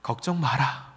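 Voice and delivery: soft, low reassurance; the Lord reassuring. Acted. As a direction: comfort gently, as the Lord. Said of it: "주님이 말씀하셔요".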